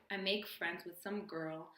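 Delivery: ordinary talk, nothing marked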